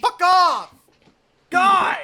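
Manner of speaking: exasperated yell. Real, not acted. Exasperated outburst at friend.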